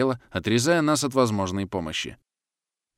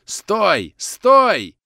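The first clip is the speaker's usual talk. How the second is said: high, loud yell to halt, building; 10 semitones higher, 7 dB louder than usual. A narrator's reading. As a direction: yell after running men to stop them. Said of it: "заорал Свиридов". (орать во весь голос, останавливая)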